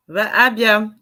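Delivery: loud call to the caller on the line; host calling the next caller loudly by name. Real, not acted.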